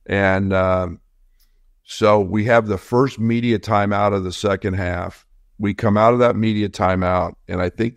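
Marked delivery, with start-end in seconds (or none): none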